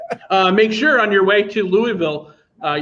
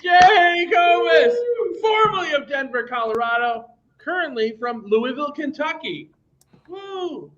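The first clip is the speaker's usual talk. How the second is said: high, stretched announcer shout; hype introduction; 10 semitones higher than usual. Real, not acted. Host hyping guest Jake for the audience, excited. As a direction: introduce him like a boxing ring announcer.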